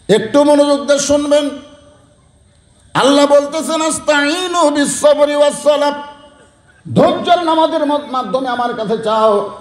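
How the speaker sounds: high, sustained preaching tone; rousing sermon. Acted. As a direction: preach it high over the congregation. Preaches a verse and its meaning.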